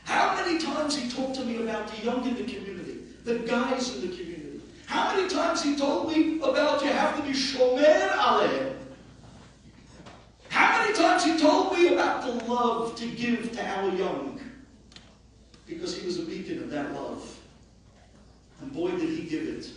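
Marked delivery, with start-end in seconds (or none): 4.9-14.4 louder, higher fervent preaching; rally the congregation; impassioned preaching. Acted. Rabbi praising the Chacham to the community, fervent. Eulogy rising to full force.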